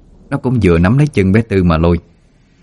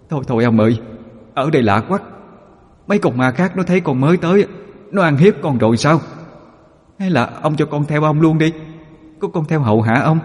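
pleading ghost; 9 semitones higher than usual. A narrator's reading. Ghost begs to follow.

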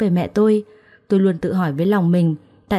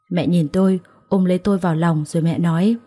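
plain reading voice

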